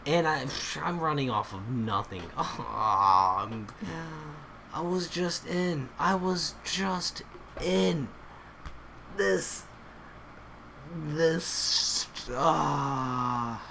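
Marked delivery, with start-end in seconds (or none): 2.4-13.6 exasperated lament. Real, not acted. Lamenting being kicked off the game.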